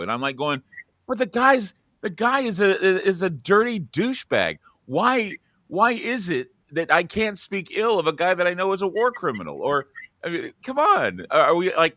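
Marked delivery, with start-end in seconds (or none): 1.1-9.6 high-pitched indignant reply; host answering angry listeners, indignant and higher; indignant retort. Retold or imitated. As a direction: protest it, higher and incredulous. Retold indignant reply to callers.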